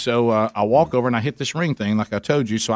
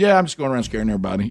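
quick joking aside; much faster than usual. Retold or imitated. Joking to cover awkwardness.

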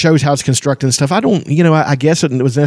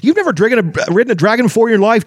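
much higher, fast and indignant; indignant kender; 11 semitones higher than usual. A narrator's reading. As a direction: call out his bluff, outraged and quick. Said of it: "Tasselhoff said indignantly".

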